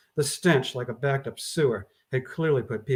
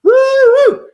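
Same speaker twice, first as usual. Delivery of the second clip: very high, loud whoop; 11 semitones higher, 13 dB louder than usual. Acted. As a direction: celebrate the stink. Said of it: "Tim Creasy agreed".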